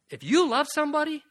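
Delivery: loud, fast, driving preacher's push; preaching at full force, loud and quick; forceful preaching. Acted. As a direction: hammer it home to the congregation. Preacher pressing the point, rising.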